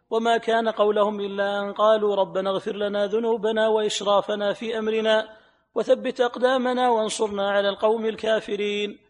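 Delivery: ordinary talk, nothing marked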